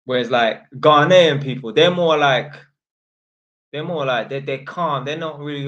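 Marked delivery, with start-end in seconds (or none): 0.0-2.5 loud emphasis. Real, not acted. Emphatic loud rant point.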